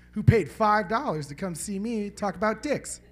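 usual speaking voice

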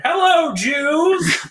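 high, loud, drawn-out holler; mock shouted greeting. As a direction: belt it out like a brash film greeting. Quoting shouted film greeting.

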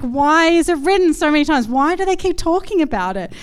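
like someone fed up with hearing the same story; exasperated complaint. Retold or imitated. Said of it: "You're like".

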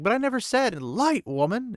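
exasperated retort. A narrator's reading. Lan answering Nynaeve, fed up and angry. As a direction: snap back in exasperation.

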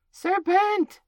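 high, very loud screech of alarm; screaming. A narrator's reading. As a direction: shriek it out as a panicked accusation. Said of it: "screamed the pigeon".

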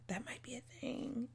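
hushed, unvoiced, conspiratorial; secret whisper. Real, not acted. Host teases listeners about a website, whispering.

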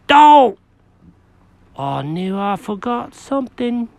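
high, fussy cat voice; Jenkins the cat. Acted. Jenkins dismayed.

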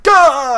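loud, high "gah" yelp; acted loud yell. Player reacting to the witches, loud mock yell. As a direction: burst out with a sudden high yell. Acts out a startled player cry.

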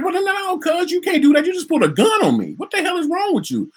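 high-pitched retold outrage; worked-up, high confrontation replayed; indignant protest. Acted protest at the departing officer.

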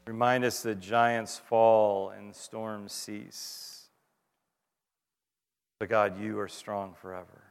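slow, hushed pastoral prayer; closing prayer. Acted. Pastor leading the congregation in a closing prayer, hushed and slow. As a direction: pray it quietly, unhurried, over the room.

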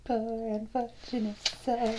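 very soft dramatic echo; soft mock echo. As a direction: breathe it back mock-tragically. Echoed softly in mock voice.

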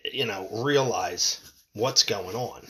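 normal talking voice

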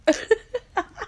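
squealing high laugh. Real, not acted. Laughing at own impression.

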